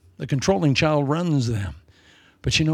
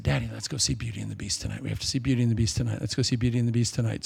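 fast, softer retold daughter's request; eager pleading; 2 semitones lower, 5 dB quieter, much faster than usual. Daughter begging daddy for a movie, fast light pleading. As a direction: rattle off an eager plea, lightly voiced.

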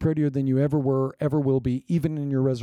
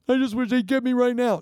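mock whiny reply; 9 semitones higher than usual. Congregant's imagined reply.

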